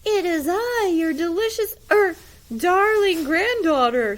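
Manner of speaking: high, loud mock girl voice from the wolf. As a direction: fake a little girl, badly. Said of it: "said the wolf in a high voice".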